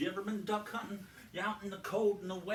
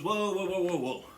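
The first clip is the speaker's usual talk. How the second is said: hasty whoa; 6 dB louder than usual. Acted. Farmer backs out hastily.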